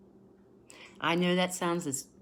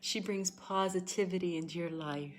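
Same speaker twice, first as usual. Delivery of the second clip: softer, slow, hushed; calm hushed tarot voice; tender soft reading; 6 dB quieter than usual. Real, not acted. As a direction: soothe them gently. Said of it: "tender card reading".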